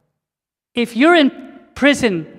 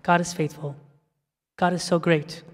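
ordinary talk, nothing marked

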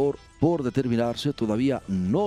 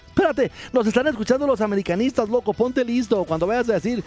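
raised, hurried sidekick warning; high mock warning voice; 10 semitones higher, 4 dB louder than usual. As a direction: squeak a quick warning. (voz aguda de advertencia)

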